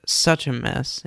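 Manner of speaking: usual speaking voice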